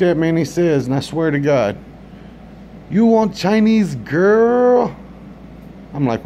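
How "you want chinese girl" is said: high, very slow put-on voice of the waiter; mock accented offer. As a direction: stretch out the offer slowly, higher. Comedian voices restaurant worker.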